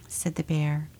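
usual speaking voice

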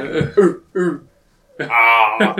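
monkey oohs and a loud ah; Clyde the Monkey impression. Shown as a demonstration. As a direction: hoot like a monkey. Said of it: "Clyde the Monkey impression".

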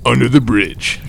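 lightly voiced show announcer; slow announcer read. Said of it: "should I do the voice".

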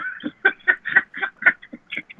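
high, breathy burst of laughter. Real, not acted. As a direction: let out a wheezing laugh. Reacts to absurd joke.

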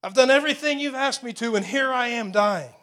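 crying out. Acted. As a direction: cry out in protest, voice lifted and pressing. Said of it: "this crying out takes place".

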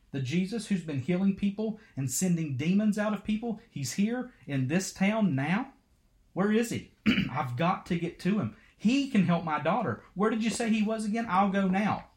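mother hearing the healer is in town, eager and urgent; urgent excitement. Acted. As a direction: rush to find him, hope rising.

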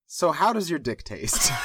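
breaking into laughter mid-line; fighting back laughter while reading; laughing. Real, not acted. Reading the blunder aloud.

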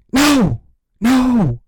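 high, loud mock-outraged cry; outraged shout. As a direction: protest explosively, hammed up for the court. Pleads case in mock outrage.

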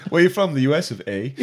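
teasing Canadian with a tagged-on "eh"; mock Canadian accent. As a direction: impersonate a Canadian, amused. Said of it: "how a Canadian finishes everything with A".

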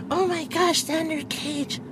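very high gushing fan; fangirl gush. Retold or imitated. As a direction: swoon over him in a high squeal. Fangirl gushing over Xander.

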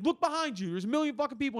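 high-pitched, urgent scolding; high, pressing complaint; exasperated bartender. Retold or imitated. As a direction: imitate a harried bartender losing patience. Bartender scolds the stalling drunk.

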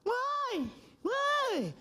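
put-on high, whiny child voice asking why; whiny kids. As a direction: squeak it out like a small kid. Children questioning mom.

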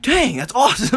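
high-pitched, impressed outburst; thrilled. Retold or imitated. Patient hears of the dentist's other famous nephew, thrilled. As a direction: react like an excited fan. Retelling thrilled reaction to eaten actor.

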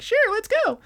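very high sweet squeak; sudden cheerful. Retold or imitated. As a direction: flip to a bright girly chirp. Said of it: "She's like".

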